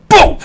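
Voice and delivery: loud, sudden boom; imitated crash. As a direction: punch out the crash noise. Imitates crash heard behind them.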